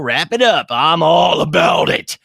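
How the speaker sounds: loud, high, drawn-out hype yell; enthusiastic shout. Real, not acted. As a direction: belt it out with gusto. Shouting approval of chat's pun.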